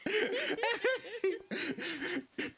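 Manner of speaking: high giggling through words; laughing. Real, not acted. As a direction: laugh while talking. Laughs after friend's outburst.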